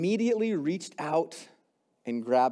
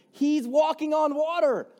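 excited; 11 semitones higher, 4 dB louder than usual. Real, not acted. Excited retelling of Peter's miracle.

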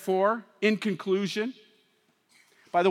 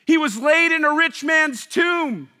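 loud, higher preaching build; full-force sermon delivery; 5 semitones higher, 4 dB louder than usual. Acted. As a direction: proclaim the resurrection.

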